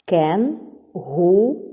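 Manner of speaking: "Кенгуру" as Кен... гу... ру, slowly; split into syllables. Shown as a demonstration. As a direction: say "Кенгуру" slowly, pausing after each syllable. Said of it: "чи правильно поділили ви". («Кенгуру» повільно, по складах)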